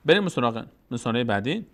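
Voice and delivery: normal talking voice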